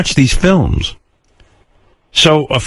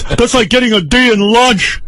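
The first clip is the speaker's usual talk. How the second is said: lightly voiced stern father, higher pitched; mock the father scolding in a higher voice; father's voice; 11 semitones higher than usual. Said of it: "He goes".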